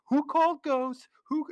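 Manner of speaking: very high, loud and slow hammed-up shout; Bill Murray impression. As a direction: belt it out like a cocky ghost hunter. Imitating Bill Murray line.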